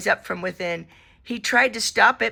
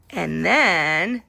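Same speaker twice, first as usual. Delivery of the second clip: plain reading voice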